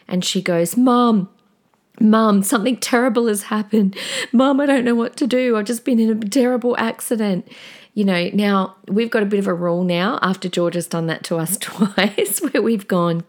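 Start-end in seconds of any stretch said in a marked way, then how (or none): 0.8-7.5 high, loud frantic pleading; a hint of crying, higher and louder; panicked daughter on the phone. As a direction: imitate a hysterical teen calling for help. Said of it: "she was crying".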